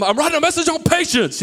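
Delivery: high-pitched, indignant mock outburst; yelling. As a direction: re-enact yelling in exasperation. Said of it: "yelling at everybody".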